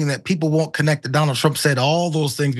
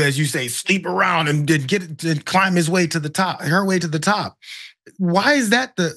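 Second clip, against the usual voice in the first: usual speaking voice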